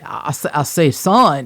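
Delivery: slow, loud Southern cartoon blowhard; mock a drawling, blustering cartoon rooster; Foghorn Leghorn. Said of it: "sounded like Foghorn Leghorn".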